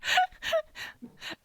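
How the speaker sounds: squeaky burst of laughter; high-pitched laugh. Real, not acted.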